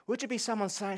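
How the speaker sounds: ordinary delivery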